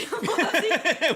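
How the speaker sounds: high loud cackle; loud burst of laughter. Real, not acted. Laughing at cuss word.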